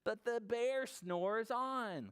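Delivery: slow, high sing-song refrain; story refrain. A narrator's reading. Storyteller on the sleeping bear, slow lilting refrain. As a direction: stretch it out like a storybook chorus.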